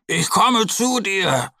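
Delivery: langsam und deutlich höher gerufen; rufend. A narrator's reading. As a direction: dem Freund tröstend zurufen. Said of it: "ruft Mo". (Bär ruft der Ente langsam zu)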